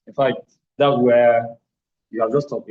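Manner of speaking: loud, pressed stress on that's where; loud emphasis. Real, not acted. Physio showing students where movement stops, loud emphasis. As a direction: hammer home the spot it stops.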